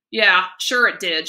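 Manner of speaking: loud, slow, bitter snarl. A narrator's reading. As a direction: snarl it sarcastically. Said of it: "she snarled".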